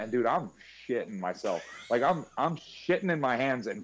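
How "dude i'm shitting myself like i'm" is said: ordinary talk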